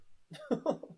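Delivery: short high burst of laughter; real laugh at the book; incredulous laugh. Real, not acted. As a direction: laugh in disbelief. Laughing at the absurdity.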